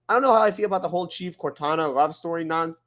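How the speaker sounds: ordinary delivery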